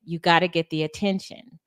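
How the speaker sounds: ordinary delivery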